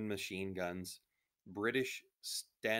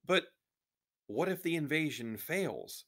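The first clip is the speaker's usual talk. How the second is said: high-pitched anxious outburst; worried, urgent question pitched high; crying out; 9 semitones higher, 5 dB louder than usual. A narrator's reading. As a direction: cry out in alarm. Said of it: "Samira cried".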